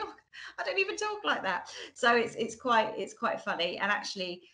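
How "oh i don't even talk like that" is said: laughing self-quote. Retold or imitated. Amused at her own changing accent.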